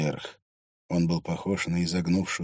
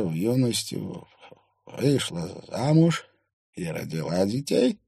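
lightly voiced old man, somewhat higher; old man character; 5 semitones higher, 4 dB louder than usual. A narrator's reading. Old man guesses about the woman's marriage, lightly voiced old man. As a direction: piece it together in a mild old man's voice, with pauses.

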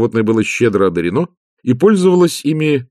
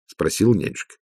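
ordinary delivery, nothing marked

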